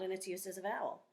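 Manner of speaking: ordinary talk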